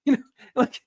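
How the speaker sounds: can't hold the laugh in, voice rising high. Real, not acted. Laughing at the seller's outrage.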